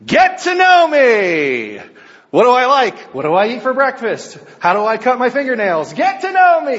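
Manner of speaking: loud, high pitched pitchman voice; infomercial guy. Retold or imitated. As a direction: hype it like a TV motivational speaker. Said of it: "this infomercial guy, ... motivational speaker".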